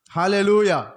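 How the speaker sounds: loud ringing Hallelujah; preacher's rousing shout. Real, not acted. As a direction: call it out to the whole congregation. Preacher's call to congregation.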